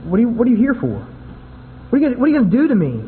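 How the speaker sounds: hint of a frightened demon, rushed; frantic demon voice. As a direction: rattle it off like a cornered spirit. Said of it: "the evil spirit speaking".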